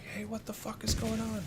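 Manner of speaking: very high-pitched squeaky voice for a dog; dog's voice. Acted. Gamer voicing their dog's reaction, very high put-on voice. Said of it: "my dog is like".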